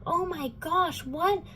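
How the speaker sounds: high breathy squeak of disbelief, whispered "oh my gosh"; hammed-up gasping surprise; mock shocked gasp. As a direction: play Clary faking total shock at news everyone saw coming. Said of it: "Clary's like".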